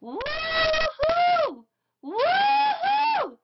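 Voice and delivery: very high-pitched, drawn-out woohoo; high, gleeful whoop of celebration; screamed cheer. Retold or imitated. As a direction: re-enact squealing with excitement, voice shooting way up. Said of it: "I was screaming".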